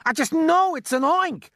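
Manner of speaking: very high, loud insistence; panicked scream. A narrator's reading. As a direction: scream it slowly, in alarm. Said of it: "screamed the head of the fire department".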